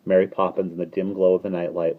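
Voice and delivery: usual speaking voice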